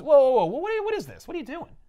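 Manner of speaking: high alarmed whoa; startled. Retold or imitated. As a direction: jump up in pitch, caught off guard. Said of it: "He goes".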